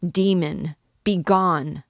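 loud, raging dismissal; furious cry. A narrator's reading. Esmeralda striking the priest, furious cry. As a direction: hurl the word at him. Struck him with fury.

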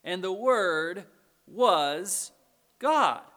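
slow right down, pause, then hit "God"; emphatic reading. Shown as a demonstration. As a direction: build to a loud, separate "God". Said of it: "Notice the next phrase".

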